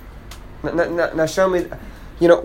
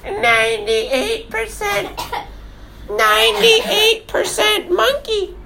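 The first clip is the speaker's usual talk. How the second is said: high, slow taunting voice; mock them in a silly singsong; much slower than usual. Dad mocking scientists to his kids, high and slow.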